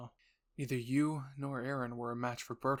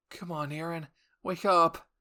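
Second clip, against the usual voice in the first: urging; 4 semitones higher, 8 dB louder than usual. Acted. Urging unconscious Aaron to wake.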